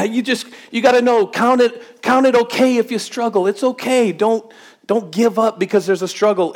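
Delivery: high, driving preacher's call; preaching at full force. Acted. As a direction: preach it out to the whole room. Preaching, urging the congregation on.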